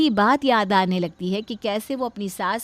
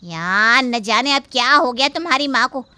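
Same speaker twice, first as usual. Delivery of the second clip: louder, exasperated grumble; annoyed complaint; 6 dB louder than usual. Acted. Wife complaining to husband at night, loud and annoyed. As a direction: wake him up, fed up.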